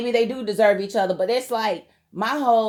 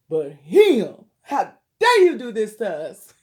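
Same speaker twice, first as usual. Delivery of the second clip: indignant outrage; 5 dB louder, slower than usual. Real, not acted. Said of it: "I got that mad".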